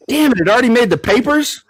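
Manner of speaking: high, loud, fast punchline cry; dismayed lion. Acted. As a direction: blurt it as the horrified lion. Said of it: "And the lion goes".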